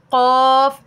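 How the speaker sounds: drawn-out, heavy q in "क़ाफ़"; model letter qaf. Shown as a demonstration. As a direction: sound out "क़ाफ़" from the back of the tongue. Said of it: "और वो क्या है?".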